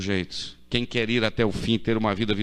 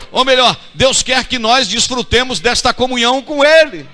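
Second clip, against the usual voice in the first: loud, high fervent preaching; preaching at full force; 7 semitones higher, 10 dB louder than usual. Acted. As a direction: drive it home to the congregation.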